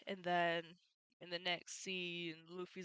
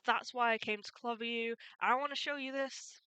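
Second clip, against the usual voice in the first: louder, higher, mildly indignant imitation; retold rage; 5 semitones higher, 6 dB louder than usual. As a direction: imitate a righteous hero squaring up to fight. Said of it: "we all felt this rage".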